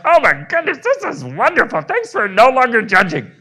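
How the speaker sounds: high, loud, delighted little voice for the body; the body's delighted voice. Pretend. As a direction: voice your body squealing with joy. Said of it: "your body's like".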